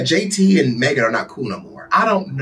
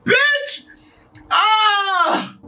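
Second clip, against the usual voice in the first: very high squealed outburst; near cry-laughing. Real, not acted. Reacting to the voodoo rumor, squealing near cry-laughter. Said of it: "I'm trying not to cry laugh now".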